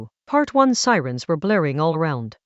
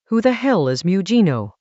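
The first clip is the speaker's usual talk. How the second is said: ordinary delivery, nothing marked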